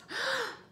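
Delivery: sudden high gasp of shock; sharp gasp. Acted. Missus Prim startled by the crocodile, sharp gasp. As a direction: gasp sharply, caught off guard. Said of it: "let out a sharp gasp".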